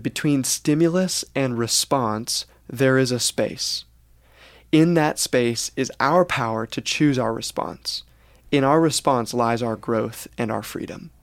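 slow solemn quote. Retold or imitated. Reading Viktor Frankl's quote aloud slowly. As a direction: quote read slowly and deliberately.

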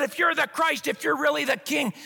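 mocking thief. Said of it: "hurled insults".

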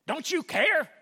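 high, loud whining question; aggrieved. Retold or imitated. A believer complaining to God. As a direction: plead for attention.

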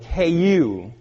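loud, high, building to a forceful peak; emphatic, compelling preacher's exhortation; passionate urgent command. Acted. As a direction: fire off each order like an impassioned commander. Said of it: "It's passionate, it's compelling, and he commands us".

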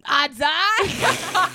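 "Odds are" squeaked high through a laugh. Retold or imitated. Imitating friend's catchphrase to a pal, high and laughing. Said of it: "you going".

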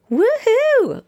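high, loud whoop; excited cheer. Pretend. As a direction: whoop it up like a party. Cheering the listener on.

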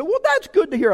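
loud reply. Retold or imitated. Answering the cashier for all to hear, loud and bright. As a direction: sing it out so the whole kitchen hears. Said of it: "and I'm loud, okay?".

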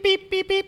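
high-pitched lock beeps; high beeping imitation. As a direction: squeak out the beeps like a keypad. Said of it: "it goes beep".

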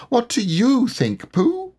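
very high, slow, timid question; nervous Piglet. A narrator's reading. Piglet asking Pooh, very high and nervous. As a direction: ask anxiously, small and unsure.

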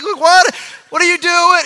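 loud, high mock toddler protest; mock kid shout. Toddler shouting at his parents over a knife, mock yell. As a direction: yell it like a toddler grabbing back.

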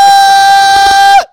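long high loud screaming wail; imitated panicked siren scream. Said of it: "It's like a siren is going off".

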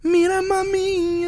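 high, slow flirty crooner; seductive Latin singer. Pretend. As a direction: purr it at the ladies. Stefan Miguel flirting with crowd.

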